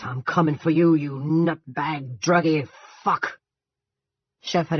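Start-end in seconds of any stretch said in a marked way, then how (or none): 0.0-3.4 louder and higher, dragged out slowly; yelling a threat toward the woods; raised voice. A narrator's reading. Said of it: "and raising his voice".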